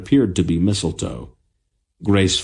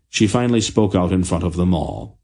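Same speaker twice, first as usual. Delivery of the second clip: ordinary delivery, nothing marked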